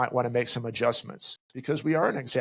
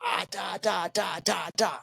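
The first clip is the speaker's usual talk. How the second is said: dismissive babble; 6 semitones higher, slower than usual. Retold or imitated. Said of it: "you wanna say".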